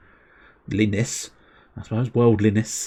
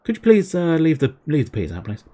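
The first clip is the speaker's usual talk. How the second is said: polite request; 6 semitones higher than usual. Retold or imitated. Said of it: "put a little note in there".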